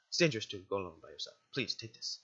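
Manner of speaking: quick, low, gentle guardian caution; understated motherly concern; motherly warning. Acted. Guardian warns, before the echo.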